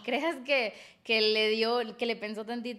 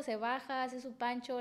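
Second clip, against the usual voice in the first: ordinary delivery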